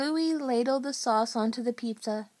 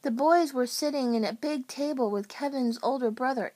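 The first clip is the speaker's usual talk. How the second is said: usual speaking voice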